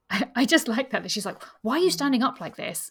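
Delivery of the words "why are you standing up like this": loud, high mock-indignant question; mock exasperation. Host retelling the heroine scolding a standing guest, mock exasperation. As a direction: imitate her demanding an explanation, higher and louder. Acted quote of the quarrel.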